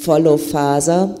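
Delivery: ordinary talk, nothing marked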